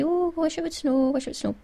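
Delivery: mock-dreamy lilt; imitating everybody. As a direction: mimic wistful snow-lovers. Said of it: "you hear everybody say".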